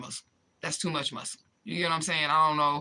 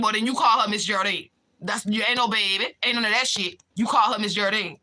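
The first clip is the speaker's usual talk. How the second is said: scolding; 4 semitones higher, 4 dB louder than usual. Acted. Scolding the Louisiana dudes.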